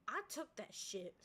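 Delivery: loud, slow gleeful boast; triumphant. Real, not acted. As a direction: boast it with glee. Story of getting money.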